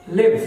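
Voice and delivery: लिव said with a short i, rhyming with give; verb live. Shown as a demonstration. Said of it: "इसका pronunciation होगा".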